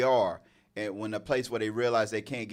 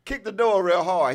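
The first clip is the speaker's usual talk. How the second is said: ordinary delivery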